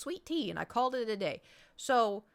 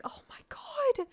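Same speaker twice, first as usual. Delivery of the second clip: slow, whispered disbelief; drawn-out, high whispered horror; mortified whisper; slower than usual. Retold or imitated. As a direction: whisper it in embarrassment. Mortified aside amid the cheering.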